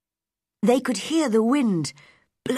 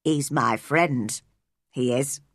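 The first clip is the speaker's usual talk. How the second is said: put-on low old gardener with a Yorkshire accent; Yorkshire accent, voice dropped low and unhurried; Yorkshire dialect; 7 semitones lower than usual. A narrator's reading. Old gardener tells Mary about his robin, in Yorkshire dialect. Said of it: "in strong Yorkshire dialect".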